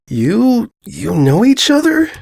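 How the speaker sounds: high, slow, shocked; stunned disbelief. Acted. Daughter shocked.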